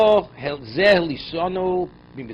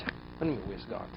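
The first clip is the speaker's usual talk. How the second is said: much softer, fading "where's God?" in disbelief; quiet rebuttal; 3 semitones lower, 14 dB quieter than usual. Real, not acted. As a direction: drop right down and answer the complaint. Teacher rebuts the complaint himself.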